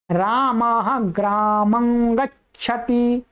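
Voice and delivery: slow, ग्रामं held on a nasal ng; nasal anusvara. Shown as a demonstration. Teacher repeating for students, anusvara spoken from the nose. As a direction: hum the end of ग्रामं as graamang before गच्छति. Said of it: "पर नासिका से बोला जा रहा अनुस्वार".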